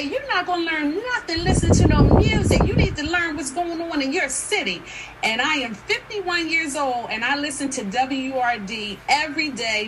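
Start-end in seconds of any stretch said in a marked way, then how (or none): 0.0-4.8 raised, high-pitched mock telling-off; imitating an insistent lecturing relative; scolding older cousin. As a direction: lay down the law like an elder to a child. Older cousin scolding her, retold.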